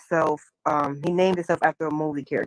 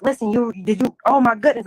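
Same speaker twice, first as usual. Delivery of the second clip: excited; 4 semitones higher, 5 dB louder than usual. Retold or imitated. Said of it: "I was so excited".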